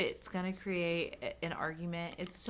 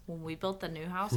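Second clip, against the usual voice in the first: usual speaking voice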